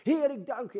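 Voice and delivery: shouted praise. Shown as a demonstration. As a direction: call it out to the sky. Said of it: "wou ik het wel uit schreeuwen". (luid uitgeroepen lofprijzing)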